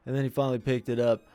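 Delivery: usual speaking voice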